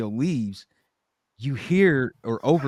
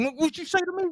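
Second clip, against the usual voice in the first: very high-pitched mock indignation; touchy challenge; faster than usual. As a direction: act out an offended man squeaking back. Acting out Ed's touchy challenge.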